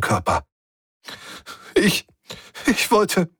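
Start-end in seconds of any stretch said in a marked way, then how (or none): 1.7-3.3 hohes, stockendes Schluchzen; sobbing stammer. A narrator's reading. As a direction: unter Tränen abbrechen. (schluchzend gestammelt, viel höher)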